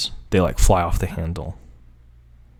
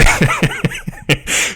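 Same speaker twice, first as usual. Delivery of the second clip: higher, breathy giggling; real laughter; 6 semitones higher than usual. Real, not acted. Reader unable to get the line out, laughing. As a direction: giggle helplessly mid-sentence. Still cracking up mid-line.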